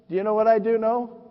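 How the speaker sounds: ordinary talk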